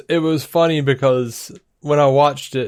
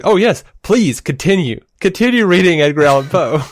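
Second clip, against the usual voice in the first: louder, higher mock-eager plea; hammed-up insistence, higher and louder; imitating exasperated wife; 5 semitones higher, 4 dB louder than usual. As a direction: play the wife's sarcastic encouragement. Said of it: "finally she was like".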